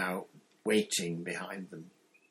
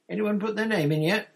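high, loud and eager; bursting with curiosity; 12 semitones higher, 7 dB louder than usual. A narrator's reading. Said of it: "Ron asked the third year girl eagerly".

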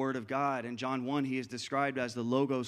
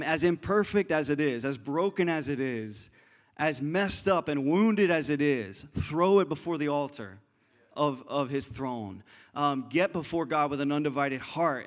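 louder, stronger preaching build; preaching with force; 5 dB louder than usual. Acted. Pastor urging the church to bring broken hearts, louder. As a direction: press each phrase harder.

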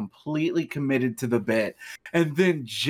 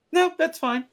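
much higher, squeaky mock answer; imitating a friend, pitched way up; high mock reply. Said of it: "Mark and I were both like".

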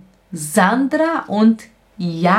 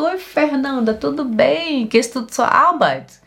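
higher-pitched, casual hello to a passer-by; friendly street greeting; 6 semitones higher than usual. Acted. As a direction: greet an acquaintance going by, lightly acted. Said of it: "Eu cumprimento". (cumprimento animado a uma conhecida na rua)

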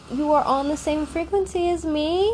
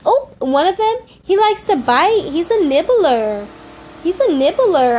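kitty talk; 6 semitones higher, 7 dB louder than usual. Real, not acted. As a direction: scold the kitten playfully in a much higher voice. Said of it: "the talking kitty talk".

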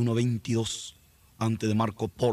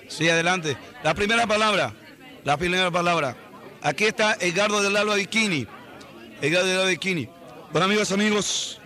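raised voice cutting through the press scrum; calling out over crowd; 7 semitones higher than usual. Acted. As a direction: shout to grab the first question. Reporter shouting over press crowd.

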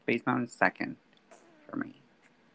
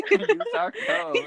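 high, slow ad voice; hammed-up sponsor plug; mock ad read; 4 dB louder than usual.

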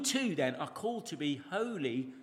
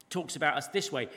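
normal talking voice

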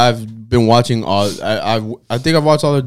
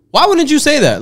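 much higher, baffled question; incredulous cop; 14 semitones higher than usual. Retold or imitated. Cops reacting to the non-alcoholic reveal, high incredulous. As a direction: throw it up high in disbelief.

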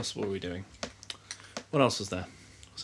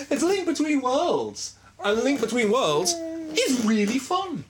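excited; 12 semitones higher, 3 dB louder, slower than usual. Real, not acted. Excited about the game.

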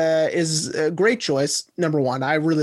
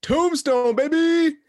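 excited cheer; 9 semitones higher, slower than usual. Real, not acted. Cheering the movie pick.

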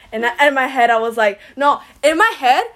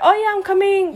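breezy promise; 8 semitones higher than usual. Retold or imitated.

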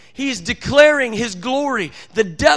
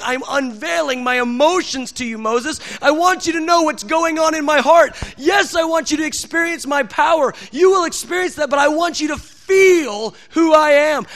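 loud, high, pleading proclamation; impassioned preaching as God; 4 semitones higher, 4 dB louder than usual. Acted. God opening his heart to Moses, fervent proclamation. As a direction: preach God's longing at full force.

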